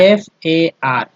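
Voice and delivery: letters spelled slowly one by one; slow spelling out. Shown as a demonstration. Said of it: "चलिए पढ़ के बताइए". (अक्षर धीरे-धीरे बोलकर)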